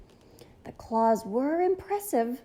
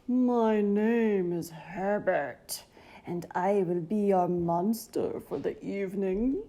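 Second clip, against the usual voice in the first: low breathy voice; 2 semitones lower than usual. A narrator's reading. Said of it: "said a low, breathy voice".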